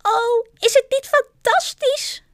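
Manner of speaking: very high, loud, elated squeal; delighted exclamation. A narrator's reading. Flying family circling together, delighted exclamation. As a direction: cry out in rapture, high and loud.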